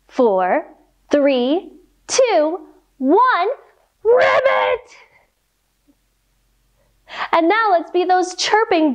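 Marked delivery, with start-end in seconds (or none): 4.0-5.0 shouted frog ribbit. Pretend. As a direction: jump and shout it like a big frog. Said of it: "Let's try it again".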